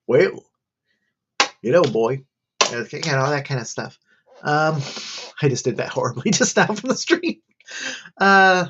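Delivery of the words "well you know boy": a light Southern drawl, a bit hammed-up; half-hearted Southern good ol' boy accent; Southern guy. Shown as a demonstration. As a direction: toss off a quick mock Southern accent. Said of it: "played it as a, a Southern guy".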